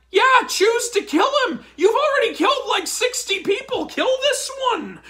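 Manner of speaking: sarcastic laughing yell; laughing sarcastic shout. Real, not acted. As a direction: shout it through laughter in disbelief.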